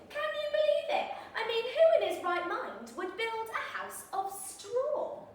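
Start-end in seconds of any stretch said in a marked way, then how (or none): none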